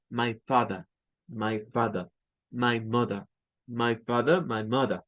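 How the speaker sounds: "father" and "mother" end in a schwa, no R; non-rhotic British. Shown as a demonstration. Teacher drilling British non-rhotic family words. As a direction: say "My father" as "my fatha", no R. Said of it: "Suena con E, no suena la R".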